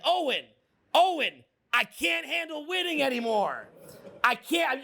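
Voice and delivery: high-pitched mock protest; mock overwhelmed. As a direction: plead as if the success is too much. Acting overwhelmed by endless winning.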